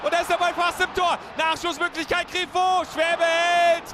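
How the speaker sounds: fast, rising radio commentary; excited play-by-play. Acted. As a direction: call the chance as it happens.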